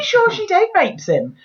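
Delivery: high, slow outraged squeal; high incredulous squeal. Real, not acted. Complaining about the film, high slow outraged squeal. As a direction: squeak it out in disbelief.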